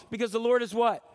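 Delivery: usual speaking voice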